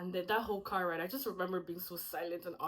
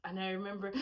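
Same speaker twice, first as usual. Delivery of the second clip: normal talking voice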